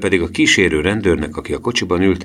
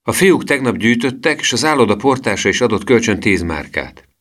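put-on high-pitched boyish eagerness; Emil's raised, mildly excited voice; eager exclamation; 6 semitones higher, 3 dB louder than usual. A narrator's reading. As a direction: report it keenly in a high voice. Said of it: "kiáltotta Emil".

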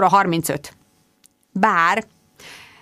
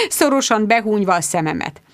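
ordinary delivery

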